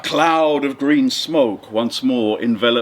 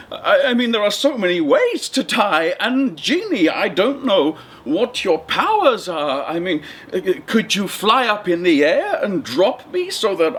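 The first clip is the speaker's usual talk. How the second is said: higher, nervous fisherman with a laugh; a frightened little man stalling for time, higher; 6 semitones higher than usual. Acted. Fisherman stalling the genie, nervous and higher-pitched.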